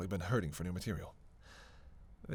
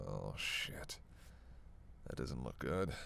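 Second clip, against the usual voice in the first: very low, soft muttered worry; hushed dismay; 12 semitones lower, 11 dB quieter, slower than usual. Acted. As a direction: mutter it low under your breath. Alarming finding during injury check.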